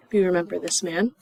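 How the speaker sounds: ordinary talk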